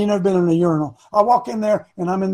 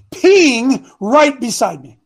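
surprised reveal; 5 semitones higher, 8 dB louder, much slower than usual. Retold or imitated.

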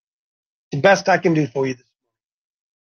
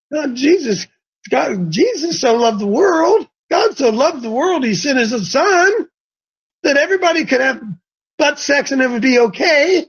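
mock the verse with a lifted, scornful lilt; sarcastic paraphrase; 7 semitones higher than usual. Preacher mocking a permissive reading of John 3:16, high sarcastic lilt.

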